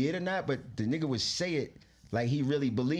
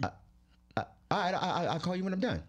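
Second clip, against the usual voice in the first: higher, stammered imitation of helplessness; distraught; 5 semitones higher than usual. Lost artist answering his unbothered manager, distraught. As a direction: stammer a meek, flustered agreement. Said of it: "I'm fucking distraught here".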